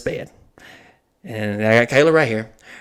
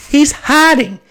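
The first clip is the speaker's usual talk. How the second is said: incredulous; 14 semitones higher, 4 dB louder, much slower than usual. Real, not acted. Laughing at the hiding clerk.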